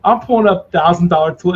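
ordinary talk, nothing marked

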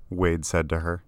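plain reading voice

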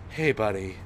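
low, gentle imitation of a fatherly director; softened, tender check-in after the yelling; endearing. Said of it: "it's just the most endearing thing".

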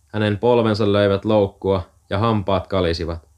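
ordinary delivery

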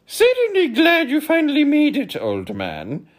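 high, loud hearty humbug voice; jovial pompous humbug; cordial humbug. Acted. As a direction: greet him like an old chum. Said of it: "said the humbug, cordially".

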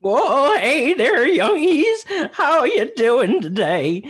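put-on creaky cranky old-timer voice; old prospector. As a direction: crackle like an old coot.

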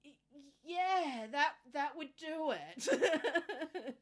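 a doctor lost for words, laughing it off; stunned doctor. Retold or imitated. Doctor taken aback by the patient's story, drawn out and slow. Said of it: "take a deep breath and just go".

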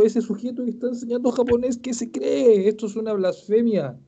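hammed-up offended objection; mock outrage. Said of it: "hubiese dicho".

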